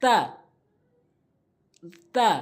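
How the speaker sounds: তা with tongue tip at upper teeth roots; letter ta demo. Shown as a demonstration. Teacher showing tongue placement, careful তা. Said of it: "উপরে দুইটি দাঁতের গোড়াতে লাগাবো".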